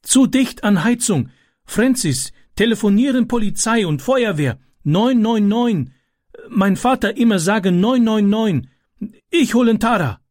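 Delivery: high, urgent calling voice; aufgeregtes Rufen. A narrator's reading. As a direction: call out instructions pitched well up. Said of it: "rief er". (hoch und dringlich gerufen)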